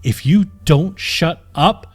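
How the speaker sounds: the knight warns of death; annoyed exclaim. A narrator's reading. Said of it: "the annoyed knight exclaimed".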